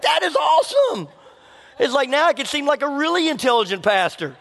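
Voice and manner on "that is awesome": mock delight. Retells delight at phone results.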